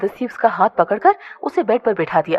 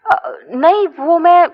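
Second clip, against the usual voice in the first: shy hesitation; 7 semitones higher, 4 dB louder, much slower than usual. A narrator's reading. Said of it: "Aditi नजरें चुराते हुए बोली".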